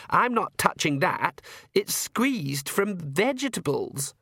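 high, drawn-out sulky refusal; disgusted daughter. Acted. Daughter refuses the wine, disgusted. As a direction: recoil in slow disgust.

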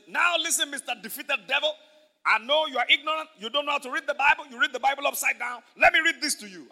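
loud, high, commanding put-down; acted, forceful scolding; scornful rebuke. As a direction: tell off a beaten enemy.

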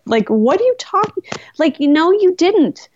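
high-pitched disbelief; incredulous retort. Retold or imitated. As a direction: squeak it out, can't believe his lie. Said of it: "I was like".

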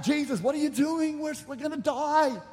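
frantic cry to wake someone, acted out; freaking out. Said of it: "freak out".

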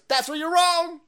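high, loud mocking retort. Answering the critics with a high mocking retort. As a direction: fire it back in a high squeal.

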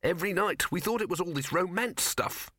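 high-pitched mouse, dismissive; unimpressed high mouse voice; mouse character. A narrator's reading. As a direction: pipe it high like a small scornful mouse. Mouse speaking in dialogue.

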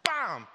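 loud, high "bam" sound effect; sudden loud burst; imitated impact. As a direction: punch it out like a hit landing. Imitates impact of thrown frog.

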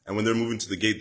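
usual speaking voice

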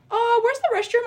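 put-on high-pitched kid voice; small, high child's question; imitated little boys. Voices little boys asking politely.

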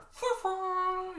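high imitated western whistle motif; Wild West music. Imitating Wild West music in the subway standoff, high vocal sound effect. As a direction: toot a high showdown tune by mouth. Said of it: "like, Wild West, like, music".

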